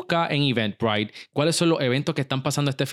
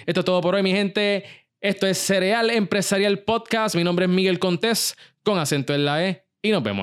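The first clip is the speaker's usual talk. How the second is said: raised, upbeat announcer sign-off; podcast outro; 6 semitones higher than usual. Acted. Host closing his podcast for his listeners, announcer style. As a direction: sign off the show like a radio host.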